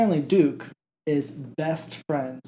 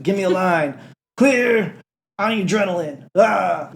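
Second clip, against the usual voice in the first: raised emergency-room commands; mock medical urgency; 4 semitones higher, 4 dB louder than usual. Medics trying to save Goat, mock urgent calls. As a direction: call out orders like a hammed-up TV medic.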